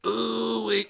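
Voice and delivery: soft robotic ooh-wee; talk box imitation. Mocking Whoopi during an imagined date, soft robotic ooh-wee. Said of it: "She's like".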